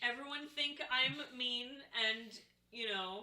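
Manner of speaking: put-on pouty baby talk, trailing off; softly whining pity plea; mock sad, broken English. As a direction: sulk like a wounded child. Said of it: "Now I am sad".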